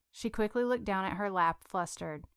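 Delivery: ordinary delivery, nothing marked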